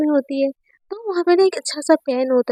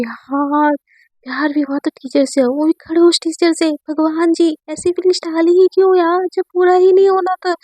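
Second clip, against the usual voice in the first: plaintive complaint, climbing higher; building, aggrieved whine to the heavens; exasperated lament; 4 semitones higher than usual. Acted. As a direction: plead with God in mock despair. Student complains to God about love.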